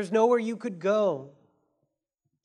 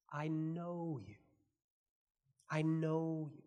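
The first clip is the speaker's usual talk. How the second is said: 1 tender; 3 semitones lower, 9 dB quieter, slower than usual. Acted. Tender quote of God.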